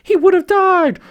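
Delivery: put-on very high, loud girlish cry; high desperate mermaid girl; Ariel voice. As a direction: falsetto daughter pleading for a life.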